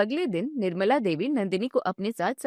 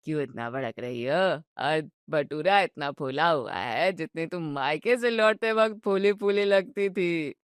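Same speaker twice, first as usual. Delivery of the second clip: put-on teasing old father-in-law; 6 semitones lower than usual. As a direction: savour every jibe like a mischievous grandfather.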